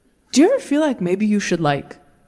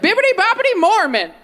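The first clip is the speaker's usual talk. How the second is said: magic spell; 10 semitones higher than usual. Retold or imitated. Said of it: "you're like".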